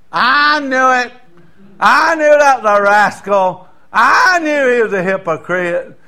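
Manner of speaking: mock the self-righteous church gossip; hammed-up told-you-so; smug hypocrite. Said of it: "some hypocrite who'll say this".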